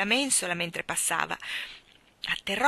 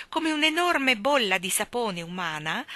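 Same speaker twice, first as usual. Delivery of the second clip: ordinary delivery, nothing marked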